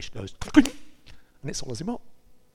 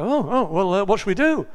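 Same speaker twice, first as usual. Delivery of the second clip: higher, louder flustered sailors with a laugh; mock-panicked crew blurting out the question; 6 semitones higher, 6 dB louder than usual. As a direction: play the sailors thrown into a fluster, half laughing. Sailors flustered at Jonah's confession.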